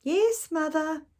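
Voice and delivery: put-on high, drawn-out child's reply; slow little girl. Girl answering her mother again, high and slow. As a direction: stretch it out like a patient child.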